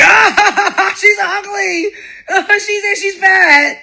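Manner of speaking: high-pitched jeering cackle through the words; crowing, laughing taunt; mocking laughter. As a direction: act out a crowd laughing at someone. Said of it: "just start laughing at me".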